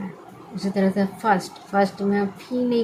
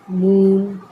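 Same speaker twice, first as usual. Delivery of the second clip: emphatic example; 7 dB louder, much slower than usual. Shown as a demonstration. Teacher reads example words.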